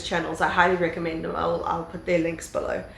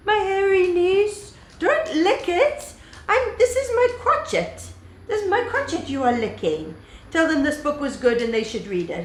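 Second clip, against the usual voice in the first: fond high scolding of a dog; squeaky coo to a pet licking you; 11 semitones higher than usual. Real, not acted.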